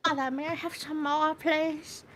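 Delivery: put-on high, pleading little child; mock Victorian child begging; childlike plea. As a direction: ask mother sweetly, hammed-up. Acting a child character.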